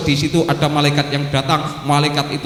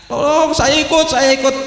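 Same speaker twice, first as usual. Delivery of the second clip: high, begging cry to ride along; man stopping a full bus, pleading to join; mock pleading; 11 semitones higher than usual. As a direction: plead like a man left at the roadside.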